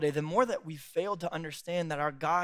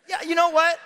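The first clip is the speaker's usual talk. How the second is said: excited; 10 semitones higher, 7 dB louder than usual. Real, not acted. Comic pivot, excited.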